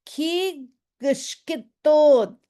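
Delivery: "Kigshketon" very slow, a clear G before the SH. Shown as a demonstration. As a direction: stretch "Kigshketon", G then SH. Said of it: "put the G in front of the S H".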